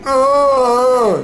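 long, very high pained scream; a sudden high cry of pain. A narrator's reading. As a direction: scream as if blinded by a bright light. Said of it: "schreie ich".

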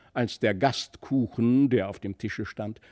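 plain reading voice

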